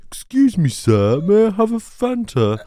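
very high, over-polite posh request; hammed-up aristocrat ordering, drawn out at the end; mock aristocrat. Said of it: "we're not all aristocrats".

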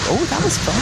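playful put-on voice for her husband; voicing Craig. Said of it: "I'm Craig".

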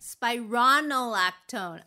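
slow spironolactone, clear spy-RON; stress on RON. Shown as a demonstration. Reader copying co-host, slow careful spy-RON-oh-lactone. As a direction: copy it slowly, RON full in spironolactone. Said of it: "Like Ron".

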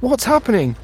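high, frightened question; terrified. A narrator's reading. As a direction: ask in panic.

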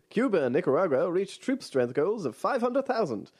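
high, punchy newsreel announcer read; old-timey radio voice. Shown as a demonstration. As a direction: announce it like a 1940s radio bulletin. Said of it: "Old-timey radio voice".

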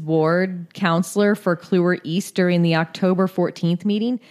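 ordinary talk, nothing marked

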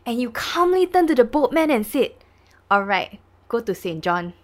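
loud, high-pitched stern courtroom questioning; strict judge. Acted. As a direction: hammer each phrase like a scolding judge. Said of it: "like, very strict and then".

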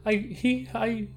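high, loud, blurting denial that trips over itself; panicked denial. Acted. Paige panicking as the interrogators press about Aaron. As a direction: panic spilling out under questioning.

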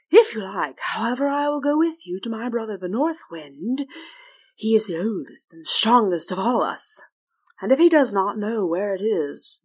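big airy storyteller wind voice; South Wind voice. Acted. South Wind speaks at length.

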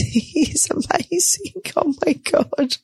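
high, slow, laughing praise; giggly, high-pitched delight; laughing admiration. Real, not acted. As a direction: gush through a soft giggle. Laughing while praising her partner.

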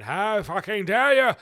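loud, high, drawn-out indignant shout; mock outrage. Granny's outrage retold by comedian, mock outraged. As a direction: stretch it out in scandalised fury.

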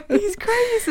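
high-pitched giggling words; laughing. Real, not acted. Laughing about Edward quote.

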